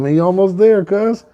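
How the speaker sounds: high, pushed cheering-on; hyped encouragement. Acted. Bull egging on the climbing turkey, high excited voice. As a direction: egg a buddy on in a high voice.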